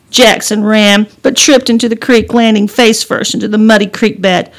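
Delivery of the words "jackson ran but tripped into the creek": usual speaking voice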